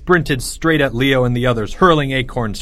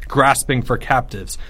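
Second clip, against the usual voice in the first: usual speaking voice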